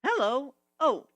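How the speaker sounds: high, loud, caught off guard; startled greeting. A narrator's reading. Twilight opening her door to a griffin, startled. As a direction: jump at the sight of him.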